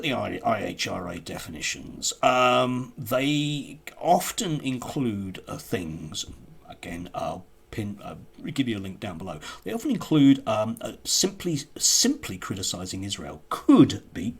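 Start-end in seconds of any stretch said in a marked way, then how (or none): none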